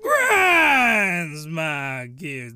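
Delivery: voice cracking. Real, not acted. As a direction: voice cracks mid-phrase. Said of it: "that voice crack".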